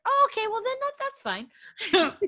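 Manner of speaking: high-pitched put-on "fine", drawn out at the end; exaggeratedly high, slow reply in a retelling; retold mock acceptance. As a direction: act out your over-the-top reply, slowing down. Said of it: "I literally went off".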